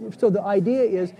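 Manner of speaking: ordinary delivery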